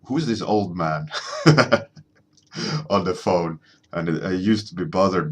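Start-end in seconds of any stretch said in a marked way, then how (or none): 0.0-3.6 lightly mimicked girl on a phone chat, with a laugh; a hint of a giggling girl mocking the caller; girls teasing. Girls on the phone chat teasing him, lightly mimicked with a laugh. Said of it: "And they always said, like".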